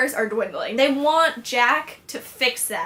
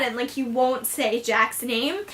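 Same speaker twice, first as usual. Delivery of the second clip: normal talking voice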